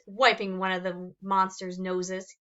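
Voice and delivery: usual speaking voice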